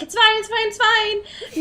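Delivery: high, strained it's fine; mock reassurance. Host retelling herself at work during the brawl, strained fake calm. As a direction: fake calm cracking at the edges. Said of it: "I'm like".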